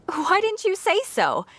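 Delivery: high, delighted rush of fan enthusiasm; light up at a fellow fan; gushing fangirl question; excited. Acted.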